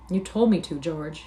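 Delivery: ordinary delivery, nothing marked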